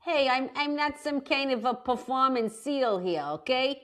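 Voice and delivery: put-on lightly done New York Italian-American accent; mock-offended New Yorker in a New York accent. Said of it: "a nice New York accent".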